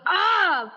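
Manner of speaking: short, high-pitched "ah" yell; acted yell. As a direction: play a bitten character crying out. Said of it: "and I yell, I go".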